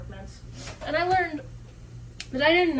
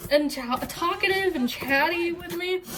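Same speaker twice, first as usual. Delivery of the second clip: ordinary talk, nothing marked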